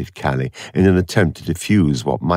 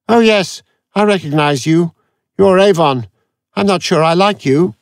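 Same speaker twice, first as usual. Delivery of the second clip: high, louder, odd computer voice; a testy supercomputer waking up; irritable machine; 13 semitones higher, 5 dB louder than usual. A narrator's reading. Aurak snaps at Avon on waking, high and testy.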